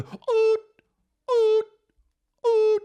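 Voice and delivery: high steady beeping imitation; monitor beeps. Imitating the hospital machine beside his wife, high beeps. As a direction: mimic a heart monitor beep.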